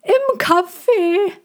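very high, slow dove voice. A narrator's reading. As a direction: repeat it like a thin piping pigeon. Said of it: "wiederholte die andere".

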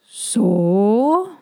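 "So" drawn out with a long rise; questioning. A narrator's reading. Old dragon asking no one in particular, questioning "So". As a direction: stretch "So" upward into a question.

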